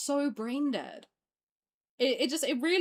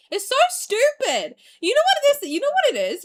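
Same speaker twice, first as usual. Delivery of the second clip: loud exasperated shout; angry outburst; 10 semitones higher, 8 dB louder than usual. Real, not acted. Streamer venting at fans about the cancellation, loud anger. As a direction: explode with frustration. Ranting, asks rhetorically.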